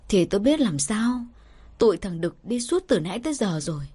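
usual speaking voice

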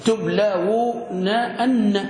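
"تبلون أن" slowly as tub-laa-wu-na-anna; syllable by syllable. Shown as a demonstration. Teacher adds emphatic nun for class, syllable by syllable. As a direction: separate each part of "تبلون أن", doubled final n. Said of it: "دخل نون التوكيد".

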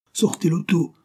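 high, pressing command; urgent order. A narrator's reading. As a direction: order it with no time to lose. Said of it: "ordonna ce dernier".

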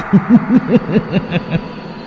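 high creepy ghost laugh; eerie ghost laugh. A narrator's reading. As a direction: laugh like a vengeful spirit. (tiếng cười ma cao vút)

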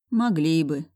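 plain reading voice

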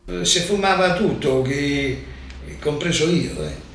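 ordinary talk, nothing marked

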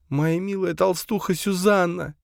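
high plaintive moan; grieving moan. A narrator's reading. Camille mourning her dead friend, grieving moan. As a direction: moan the name high, in grief. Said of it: "простонала Камила".

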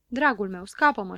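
plain reading voice

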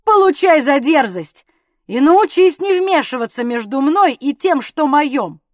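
loud, aggressive threat; angry, forceful telling-off; violent warning. A narrator's reading. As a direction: warn him off at full voice. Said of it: "сопровождавшегося следующим предупреждением".

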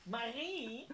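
van veraf geroepen, veel zachter; roepen van ver. Acted. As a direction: roep haar vanuit een andere kamer. Moeder roept uit andere kamer. (moeder roept Marie van een afstand)

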